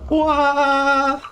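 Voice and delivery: high, sustained operatic "wah"; opera-like wail. Retold or imitated. Imitating a woman in a video, operatic wail. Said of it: "was that your opera impression".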